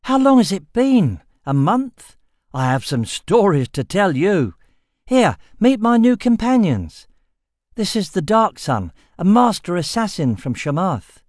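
high, bright eager youth voice; excited young man. A narrator's reading. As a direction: bubble over with boyish excitement. Boy greets eagerly, young voice.

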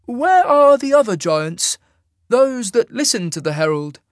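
high, loud, measured interrogation; questioning immortal. A narrator's reading. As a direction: press the giant in a high, commanding voice. Isaac's character voice.

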